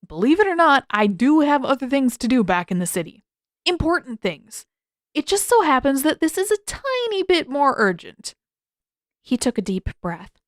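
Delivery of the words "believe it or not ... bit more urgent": higher and louder, pressing the point; emphatic insistence. A narrator's reading. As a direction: push back hard, he means it. Sokka insisting in an argument.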